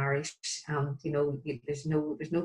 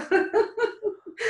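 short laugh, much higher; bright laugh. Real, not acted. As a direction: laugh at your own joke. Laughs at own remark.

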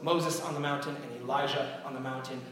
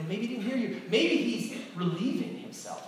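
higher-pitched teasing sarcasm; sarcastic taunt; 8 semitones higher than usual. Acted. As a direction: needle them with a pretend-helpful guess. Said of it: "a great sarcastic tone".

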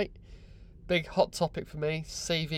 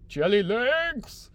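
comic exclamation; 8 semitones higher, 4 dB louder, much slower than usual. Pretend. Mocking tired hurdlers at the end.

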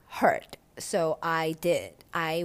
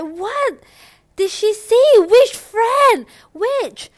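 high-pitched, loud wailed questions; mock crying; 12 dB louder, much slower than usual. Mister C asking which friend told her, mock crying. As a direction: imitate a frantic, tearful man demanding answers. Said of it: "he was just crying out loud".

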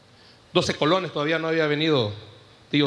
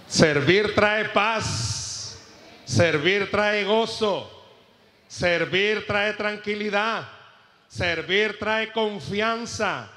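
slow emphatic refrain; 3 semitones higher, much slower than usual. Shown as a demonstration. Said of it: "preacher hammers a refrain".

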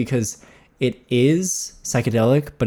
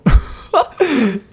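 high, loud laugh that breaks out; burst of laughter; 13 semitones higher, 5 dB louder than usual. Real, not acted. Laughing at the cat pooping mid-recording. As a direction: crack up at the cat.

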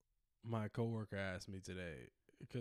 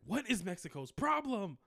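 louder, higher mock rant; 10 semitones higher, 6 dB louder than usual. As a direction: blurt it out like a riled-up bigot. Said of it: "you're like".

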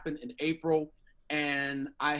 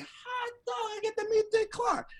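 very high, giddy mock squeal; starstruck excitement. Warm-up comic about to meet his idol, squealing starstruck. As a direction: re-enact giddy disbelief in a squeaky voice. Giddy reaction to meeting star.

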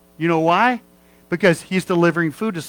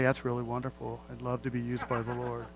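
much softer call-and-response line; congregation response; 4 semitones lower, 11 dB quieter than usual. Shown as a demonstration. Preacher leading the congregation's response, much softer. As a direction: lead them softly. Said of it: "Everybody say".

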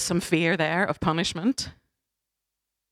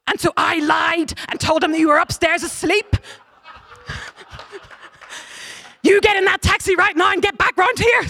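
high, loud, frantic scolding mum; angry mum on the phone; 10 semitones higher, 4 dB louder than usual. Retold or imitated. Mum ordering her daughter home, frantic and rising to a shout. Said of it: "She got a call from her mum saying".

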